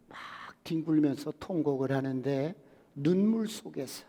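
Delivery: ordinary delivery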